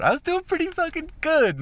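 high mock-proud voice. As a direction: say it up high, pleased with yourself.

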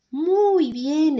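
bien alto y fuerte, con alegría; exclamación entusiasta. A narrator's reading. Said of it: "exclama el grillo". (exclamación entusiasta del grillo)

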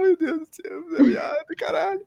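high, tearful cry of joy; crying happy tears. Retold or imitated. Retelling the nomination news, high tearful joy. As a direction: sob it out overjoyed. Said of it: "chorava feliz".

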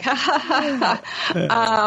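a little nervous fake laugh. Shown as a demonstration. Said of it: "like, nervous laugh after".

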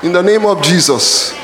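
ordinary delivery

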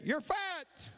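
high mimicked taunt; echoed holler. Echo answering the boy's insult, high holler. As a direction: toss the high call straight back. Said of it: "And the voice said".

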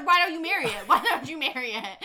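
loud teasing through laughter; laughing taunt. Real, not acted. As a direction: tease loudly, cracking up as you go. Teasing co-host while laughing.

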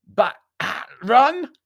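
high, loud foreign outburst; harsh jerked-out consonants. A narrator's reading. Stranger correcting the name, harsh foreign consonants. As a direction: jerk the sounds out harshly and loudly. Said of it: "a string of harsh-sounding consonants".

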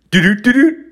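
high, loud, quick heartbeat noise; racing heartbeat. Retold or imitated. Comic imitating his heart speeding up, high racing thumps. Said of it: "my heart trying to speed up".

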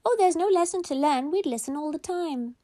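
put-on high, quick children's voices; eager kids. A narrator's reading. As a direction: blurt it out high and fast like kids. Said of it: "And the kids said".

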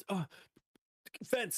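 high, stunned stammer then a yelped fence; tongue-tied shock, pitch jumping up. Retold or imitated. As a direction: freeze up and squeak out the word. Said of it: "I was in such shock".

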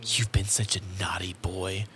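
soft, teasing ASMR whisper; hushed, playful scolding in a whisper; whispered roleplay. Acted. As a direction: whisper it seductively into the ear, mock-sultry. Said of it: "She's whispering in my ear".

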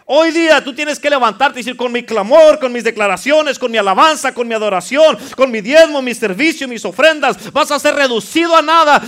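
high, loud, building proclamation; loud declaration. Acted. Preacher leading a bold declaration, loud and rising. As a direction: proclaim it like a battle cry.

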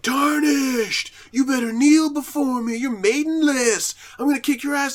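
booming high yelling boss voice; Godfrey. Retold or imitated. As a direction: roar it like a furious undead king. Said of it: "Godfrey was like".

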